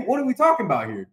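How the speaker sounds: usual speaking voice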